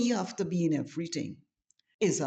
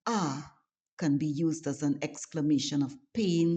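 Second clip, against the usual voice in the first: usual speaking voice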